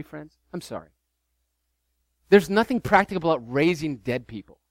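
ordinary talk

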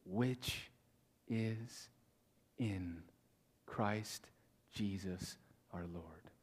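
"Which is in Christ Jesus our Lord" one word at a time, long pauses; slow, hushed, weighty "Which is in Christ Jesus our Lord"; emphasis on final words. Shown as a demonstration. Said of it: "read it with emphasis on the final words".

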